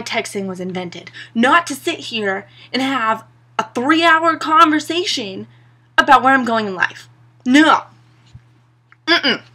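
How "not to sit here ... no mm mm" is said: heated rant. Real, not acted. Ranting against long text chats.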